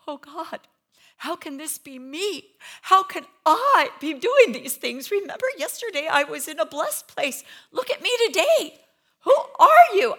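climbing into a high, loud frantic cry; panicked wail building to a shout; mock hysterical outburst. Preacher reliving her meltdown, crying out to God, climbing to a high shout. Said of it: "And I'm like".

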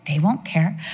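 ordinary talk

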